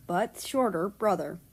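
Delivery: ordinary delivery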